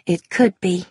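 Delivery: usual speaking voice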